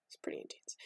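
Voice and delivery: breathy hushed comment; hushed storytelling aside; whispered aside. Real, not acted. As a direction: drop to a whisper.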